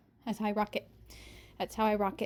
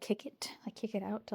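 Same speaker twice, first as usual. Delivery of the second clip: whispering; 8 dB quieter than usual. Real, not acted. Whispering aside.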